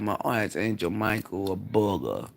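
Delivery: ordinary delivery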